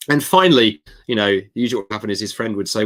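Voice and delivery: ordinary delivery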